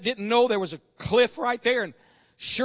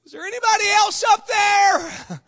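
dangling man calling up; 9 semitones higher, 4 dB louder than usual. Acted. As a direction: shout upward, hoping for someone else. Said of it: "And the man says".

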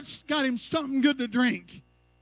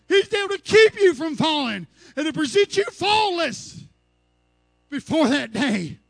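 loud, rising exhortation; preaching climax; 4 semitones higher, 6 dB louder than usual. Acted. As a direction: build it up and lift the congregation.

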